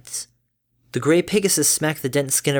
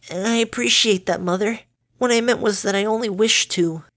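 put-on lightly raised, formal young voice; a touch of a studious filly; polite daughter voice; 9 semitones higher than usual. Dinky voiced.

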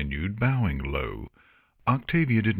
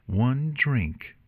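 tight, higher-pitched concession; curt; 6 semitones higher than usual. A narrator's reading. Luna giving in to Artemis, curt. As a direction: concede grudgingly and curtly. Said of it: "Luna replied curtly".

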